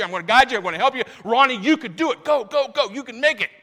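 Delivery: rising, loud cheering encouragement. Acted. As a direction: cheer Ronnie on like a coach at the finish. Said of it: "We would say it like this".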